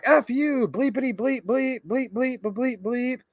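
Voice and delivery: high-pitched sing-song bleeped cursing; mock swearing tirade. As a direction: imitate an angry commenter's censored rant. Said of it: "he comes back".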